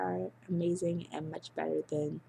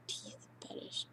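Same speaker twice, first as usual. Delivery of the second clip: whisper; 15 dB quieter than usual. Real, not acted. Whispered word at sentence end.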